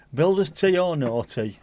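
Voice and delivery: put-on northern accent. Tea fan joking about builders tea, in a northern accent. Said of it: "a northern accent".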